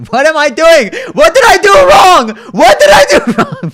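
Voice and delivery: loud, very high fake wail with a laugh; hammed-up fake sobbing whine; mock crying. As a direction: ham up the crying. Mock cry after being accused.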